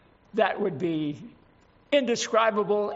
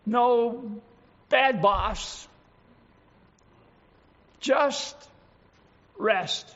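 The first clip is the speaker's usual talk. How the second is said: relishing relief; 3 semitones higher, 3 dB louder, slower than usual. Acted. Preacher relishes weekend freedom.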